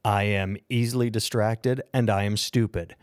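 ordinary talk

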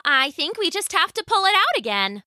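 high-pitched character voice; high-voiced, helpful old wife. Voices wife advising husband.

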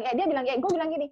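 ordinary talk, nothing marked

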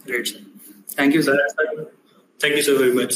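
normal talking voice

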